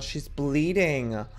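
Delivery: ordinary delivery, nothing marked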